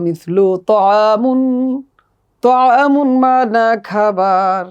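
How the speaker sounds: তআমুন said slowly with Arabic ত; slow Arabic model reading. Shown as a demonstration. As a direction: drawl out তআমুন for the class to repeat. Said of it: "আসুন আমরা একসাথে পড়ি". (তআমুন ধীরে আরবি উচ্চারণে)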